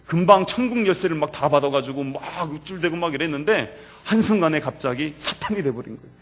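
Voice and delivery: normal talking voice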